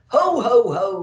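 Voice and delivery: belly-laugh like jolly Santa. Acted. Santa racing off in the sleigh, laughing heartily. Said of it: "laughed Santa".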